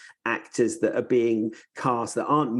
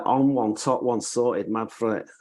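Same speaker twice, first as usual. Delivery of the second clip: nasally Mancunian; Manchester accent. Shown as a demonstration. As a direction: demonstrate a nasal Manchester hook phrase. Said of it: "It's like Manchester".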